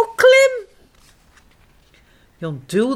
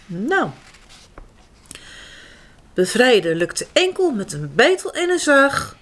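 plain reading voice

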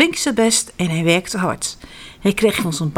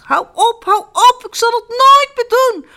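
high, loud, panicked begging; frightened plea; 5 dB louder than usual. Acted. Beaten innkeeper begs the boy to stop, terrified. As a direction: beg for mercy in terror. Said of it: "riep de man angstig".

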